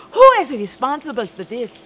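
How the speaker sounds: mother demanding who did it; angry holler. Retold or imitated. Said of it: "she spoke so angrily".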